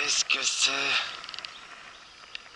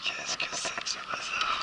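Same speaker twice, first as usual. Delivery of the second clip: chuchoté; 8 dB quieter than usual. Real, not acted. Lutte avec la prise.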